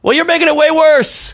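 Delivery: high-pitched mock exasperation; retold frustrated comeback; exasperated. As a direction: protest to someone who won't let up. Retelling exasperated reply to girlfriend.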